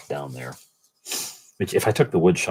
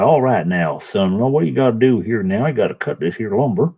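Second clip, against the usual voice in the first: bad Southern accent. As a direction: talk to yourself in a silly Southern accent. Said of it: "in a bad Southern accent".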